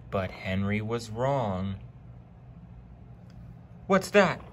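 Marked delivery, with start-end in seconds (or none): 3.9-4.4 high startled cry; cried out. A narrator's reading. Henry startled in the dark, crying out. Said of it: "cried Henry".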